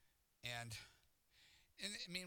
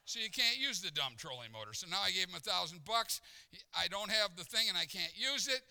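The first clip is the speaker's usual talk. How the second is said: loud, higher-pitched retold grievance; exasperated rant; 5 semitones higher, 9 dB louder than usual. Preacher recounting the useless trolling motor, exasperated. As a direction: build up the aggrieved complaint. Exasperated retelling of brother's mistake.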